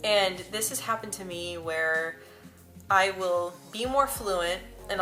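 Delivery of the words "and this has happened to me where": usual speaking voice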